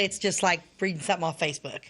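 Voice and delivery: ordinary talk, nothing marked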